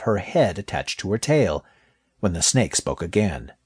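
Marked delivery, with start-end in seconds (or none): none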